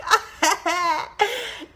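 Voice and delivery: high giggle bursting out; happy giggle at the news; excited laugh. Real, not acted. Sharing her hormone start date, giggling.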